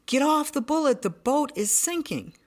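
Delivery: high, urgent shout of warning; brother-in-law yelling a warning from the boat; retold yell. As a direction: call it out across the water in alarm. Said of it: "we hear my brother-in-law yell".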